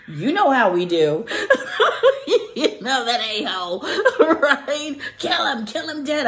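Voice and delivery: talking through bursts of laughter; cracking up while mimicking an online mob; laughing. Real, not acted. Laughing as she mimics bloodthirsty comments.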